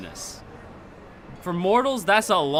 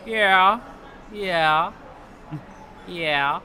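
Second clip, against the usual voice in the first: Jeff Bridges drawl; 4 semitones higher, much slower than usual. Retold or imitated. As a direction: lay back and stretch each yeah like Jeff Bridges. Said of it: "And he said it in the Jeff Bridges".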